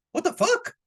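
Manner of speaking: very high shocked yelp; disgusted squeal. Real, not acted. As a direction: squeal it in mock horror. Grossed-out reaction to own image.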